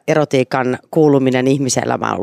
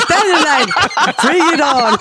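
high, laughing bravado; laughing eagerly; slower than usual. Real, not acted. Volunteering to read the erotic excerpt, laughing. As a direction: laugh it out, daring them.

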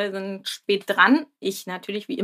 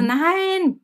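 put-on high, drawn-out little-kid no; child's refusal; 8 semitones higher than usual. Daughter turns down walking with Papa, imitated whiny refusal. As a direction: mimic a child's long, high-pitched refusal.

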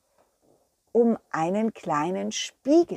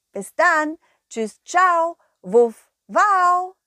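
louder, higher happy farewell barks; cheery goodbye; 7 semitones higher, 6 dB louder, much slower than usual. Acted. The dog saying bye to the children, bright and barky. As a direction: bark goodbye happily.

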